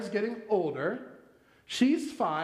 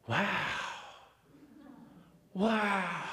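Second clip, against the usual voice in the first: soft awed little wows; small child voice; 4 dB quieter than usual. Retold or imitated. Father imitating baby daughter at fireworks, small awed voice. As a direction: breathe it like a toddler watching fireworks. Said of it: "a little small voice".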